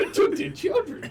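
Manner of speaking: put-on high old-timer voice through laughter; old prospector. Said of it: "prospector bit".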